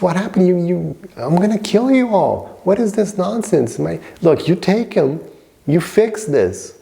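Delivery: high-pitched acted tirade; very angry. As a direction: play an outraged father berating his child's teachers. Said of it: "he's very angry".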